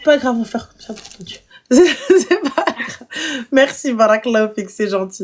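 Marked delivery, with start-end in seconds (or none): 1.5-3.1 laughing. Real, not acted. Laughs off caller's complaint.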